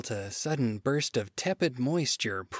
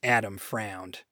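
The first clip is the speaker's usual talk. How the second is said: plain reading voice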